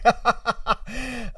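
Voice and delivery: real, high laugh. Real, not acted. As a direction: crack up after the joke. Cracks up at the shower curse.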